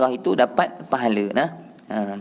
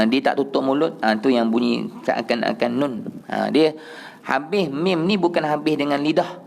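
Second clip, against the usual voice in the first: ordinary talk, nothing marked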